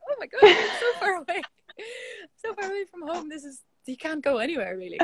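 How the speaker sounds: high-pitched retold gush, laughing midway; amazed reaction. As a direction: reenact giddy disbelief.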